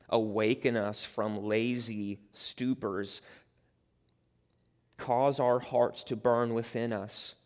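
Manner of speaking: earnest, measured pastoral prayer. Acted. Pastor praying for the church before the sermon, earnest prayer.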